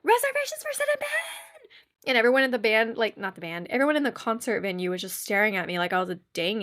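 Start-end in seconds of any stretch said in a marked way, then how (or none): 0.0-1.1 very high, rapid squealed announcement; shrieky mock holler, stretched at the end; calling out. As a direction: page your friends in a silly high squeal. Re-enacts calling out for friends.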